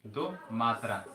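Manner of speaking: slow, stretched दो मात्रा; drawn out slow. Shown as a demonstration. Teacher showing class a long vowel, slow and stretched. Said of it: "ज्यादा समय".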